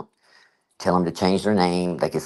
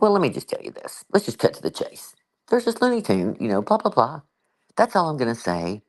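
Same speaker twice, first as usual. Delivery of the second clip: retold phone call; 6 semitones higher than usual.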